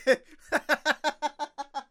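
high, loud cracking laugh; can't hold in the laugh; burst of laughter. Real, not acted. Friend hears the phrase enlarged foot, real burst of laughter. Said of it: "Did you just say an enlarged foot?".

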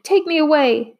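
high, panicked wail. A narrator's reading. Maria wails to Tom to take her away. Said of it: "she wailed".

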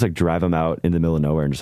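normal talking voice

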